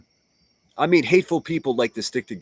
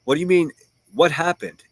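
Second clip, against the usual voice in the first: mock curious; 6 semitones higher, 4 dB louder than usual. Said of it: "mocking reply to a message".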